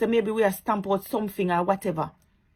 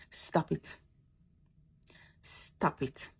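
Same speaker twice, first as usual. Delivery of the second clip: hushed, breathy stop it; quiet whispered warning to whoever is bullying; whispered plea; 5 semitones lower, 11 dB quieter, much slower than usual. Real, not acted. Pleading with online bullies.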